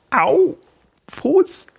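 sharp high cry at a cramp; a sudden pained yelp. Real, not acted. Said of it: "ich bekomme gerade einen Krampf". (schmerzhafter Aufschrei)

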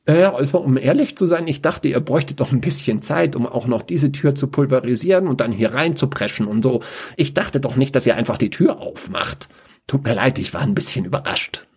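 a dark mage embarrassed by the open door. Acted. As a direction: stammer an awkward excuse.

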